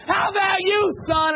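high, booming call-out; shouting preacher. Retold or imitated. Preacher giving the invitation singles out a youth, loud high shout. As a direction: holler it straight at one person in the pews. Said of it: "He said".

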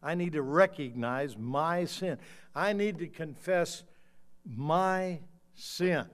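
slow, loud confession from the pulpit; emphatic preaching. Acted. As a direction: land each phrase hard, with weighty pauses.

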